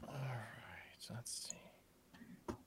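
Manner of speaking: whispered to self; whispering. Real, not acted. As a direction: whisper while counting dice. Muttering over dice.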